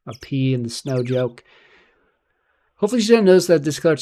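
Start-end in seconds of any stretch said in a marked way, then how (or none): 2.8-4.0 louder and quicker, higher pitch; loud fast character read. Acted. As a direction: rattle it off loudly in a higher character voice. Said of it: "Hopefully she doesn't notice that this".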